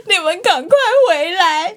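high, slow, whimpering plea; mock crying. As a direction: imitate a friend sobbing on the phone. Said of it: "然后你就在哭".

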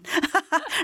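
high-pitched amused laugh. Real, not acted. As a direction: burst into a short high laugh. Laughing at her retold answer.

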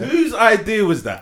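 loud, high, slow disbelief; incredulous. Real, not acted.

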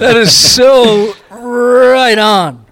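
laughing exclamation. Real, not acted. Host to guest, crowing with laughing delight. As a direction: let the delight spill out, laughing.

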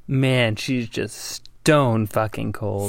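slow low awe. Real, not acted. Awed verdict.

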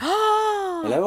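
high, far-off call into emptiness. Acted. Abandoned soldier calling for the vanished king. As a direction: call out for a king who isn't there.

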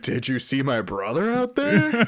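high, slow imitation through laughter; amused mock disbelief. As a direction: stretch the question out, half laughing. Imitating film boss, amid laughter.

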